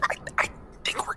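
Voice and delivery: fast, hushed whisper. Acted. Aside to companions so Maximilian cannot hear, whispered. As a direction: whisper it quickly under cover.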